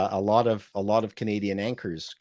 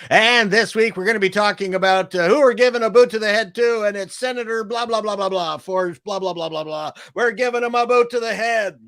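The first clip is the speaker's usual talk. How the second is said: high, loud hyped-up DJ patter; radio DJ impression; 10 semitones higher, 6 dB louder than usual. Radio DJ announcing the politician to get booted, hyped-up and loud. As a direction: belt it out like a shock-jock. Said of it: "a lot of D Js used".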